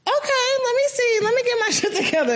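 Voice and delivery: very high, laughing "oh, okay"; startled. Retold or imitated. Hearing the brother's calm warning, high startled laughing reply. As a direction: caught off guard, scramble.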